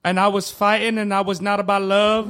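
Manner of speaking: Charles Barkley impression. Shown as a demonstration. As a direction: ramble earnestly like the TV analyst. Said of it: "Charles Barkley".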